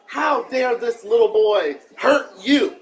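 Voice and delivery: loud, slow indignant scolding; mock outrage. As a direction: punch out each word in hammed-up outrage. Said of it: "then I was like".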